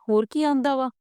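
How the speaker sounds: thrilled wife asking what else the TV shows; happy excitement. A narrator's reading. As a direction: ask it bubbling with joy. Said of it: "ਗੁੱਡੀ ਬਾਹਲੀ ਖੁਸ਼ ਹੋਈ ਤੇ ਬੋਲੀ".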